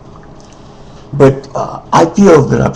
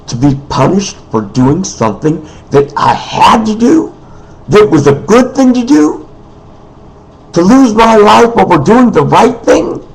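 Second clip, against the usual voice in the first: aggrieved protest; 7 semitones higher than usual. Real, not acted. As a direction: pitch climbing in indignant disbelief. Protests being punished for doing good.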